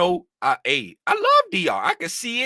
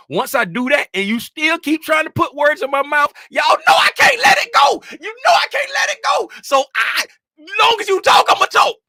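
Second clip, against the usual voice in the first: fired up shout; 11 semitones higher, 7 dB louder than usual. Real, not acted. Fired up retelling argument.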